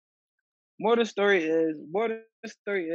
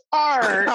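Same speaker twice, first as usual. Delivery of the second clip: laughing cry; 5 semitones higher, 7 dB louder than usual. Real, not acted. Laughing fit after friend's joke.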